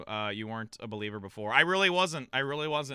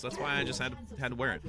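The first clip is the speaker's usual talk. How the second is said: ordinary talk, nothing marked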